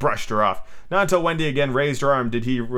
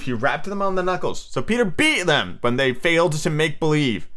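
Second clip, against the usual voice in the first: ordinary delivery, nothing marked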